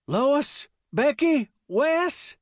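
high, urgent calls by name; calling out. A narrator's reading. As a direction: call out anxiously for your family. Father calls his family after attack.

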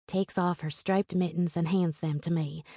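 usual speaking voice

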